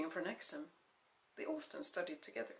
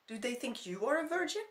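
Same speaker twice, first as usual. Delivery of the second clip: furious; 6 semitones higher, 4 dB louder than usual. Acted. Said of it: "replies came quickly and furiously".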